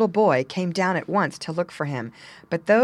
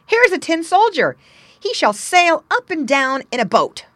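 put-on loud, higher excited street boy; 11 semitones higher, 8 dB louder than usual. A narrator's reading. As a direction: shout it with glee. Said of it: "cried one".

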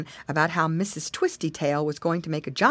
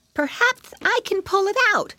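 put-on high, childlike piggy voice; a small high voice, offering help; little piglet; 12 semitones higher than usual. A narrator's reading. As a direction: pitch it up like a small, helpful piglet. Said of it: "said Flop".

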